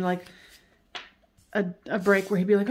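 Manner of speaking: ordinary talk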